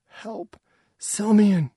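high, urgent call in labour; high-pitched plea; cry for help. A narrator's reading. Woman in labour calls for help.

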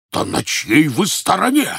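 high, loud outraged bellow; roaring. Acted. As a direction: roar the question in fury. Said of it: "проревел Пётр Иванович".

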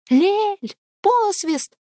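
high, loud desperate call; shouting. A narrator's reading. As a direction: call out for help across the forest. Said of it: "закричала Лада".

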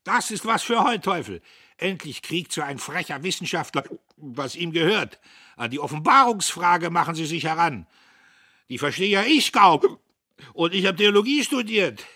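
high, loud pastor in triumph; gloating, over-excited outburst; violent exulting. A narrator's reading. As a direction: crow until it nearly brings on hiccups. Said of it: "frohlockte so heftig, dass sich Schluckauf einstellte".

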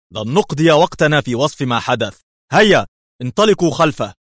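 high, loud exasperated order; irritated. A narrator's reading. Chief cutting off his men, loud angry order. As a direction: snap them into action. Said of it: "في حنق".

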